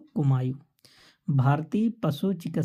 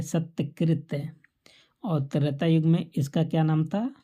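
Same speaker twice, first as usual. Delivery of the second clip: usual speaking voice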